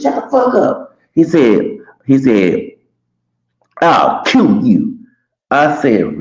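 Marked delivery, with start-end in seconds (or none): 0.0-1.0 mock yell. Dude yelling back. 3.8-5.0 threat. Retold or imitated. Dude threatening to kill.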